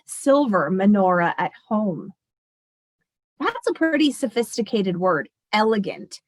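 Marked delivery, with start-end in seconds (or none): none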